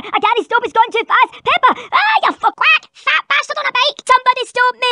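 high panicked yelling; hammed-up panic on a runaway bike. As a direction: shout in comic panic, out of control. Dad's bike runs away.

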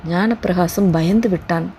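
ordinary delivery

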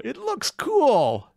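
high, slow, drawn-out wonder; mock awe. Real, not acted. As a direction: marvel then deflate it.

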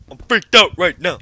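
loud, high freaked-out outburst. Real, not acted. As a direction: punch out each word, rattled.

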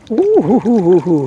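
ordinary talk

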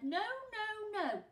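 loud, high no no no; chorus of protest. Acted. Animals refusing to let the villains take Joe, loud. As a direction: animals crying out together. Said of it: "all of the animals said".

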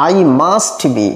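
very slow, words drawn out; slow model sentence. Shown as a demonstration. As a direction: lay it out slowly for learners. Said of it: "teacher models the sentence". (ধীরে ধীরে বলা)

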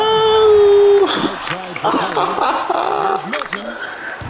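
high cracking laugh. Real, not acted. Gamer mocking his opponent's miss, high cracking laugh. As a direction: laugh so hard the voice goes high.

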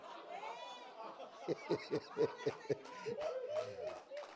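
long rising burst of laughter; big high laugh. Real, not acted. Preacher cracking up at his Popeye joke, laughing.

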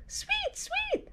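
very high soft birdsong chirp; tiny high bird tweet. Acted. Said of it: "said Bird".

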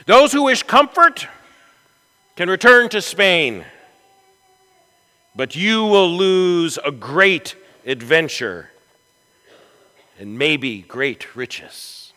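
high, loud challenge to weary men; rallying commander's address; Pizarro. Acted. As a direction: issue a bold ultimatum, loud and high. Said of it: "He looked them in the eye and he said this".

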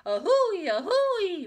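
high, loud two-note wail; wailing train whistle. A narrator's reading. Child imitates locomotive, wailing. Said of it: "wailed Ramona".

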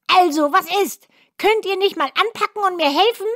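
hohe, keifende Zwergenstimme, schnell und gereizt; zeternder Zwerg. A narrator's reading. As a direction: schimpf wie ein ungeduldiger alter Zwerg. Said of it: "und fing an zu schreien". (hohe, zeternde Zwergenstimme)

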